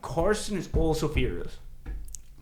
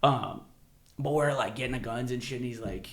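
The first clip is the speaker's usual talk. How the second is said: ordinary talk, nothing marked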